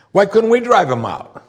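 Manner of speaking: high-pitched baffled question; puzzled protest. Acted. Disciples' puzzled question, acted.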